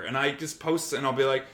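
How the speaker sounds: ordinary talk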